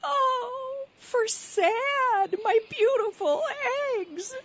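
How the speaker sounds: high wailing lament over the eggs; crying. Acted. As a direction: wail it through tears. Mother bird mourns lost eggs.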